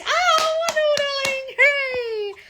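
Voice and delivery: very high, sing-song and gushing; mock sweet falsetto. To her fans reading comments, a high cooing diva voice. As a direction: coo the praise way up high like a pleased diva.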